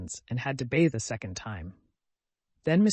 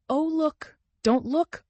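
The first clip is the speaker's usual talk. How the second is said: very high, startled exclamations; shocked cry; 14 semitones higher, 5 dB louder, slower than usual. A narrator's reading. Mother spotting the naked vicar, high and startled. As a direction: gasp it out in alarm.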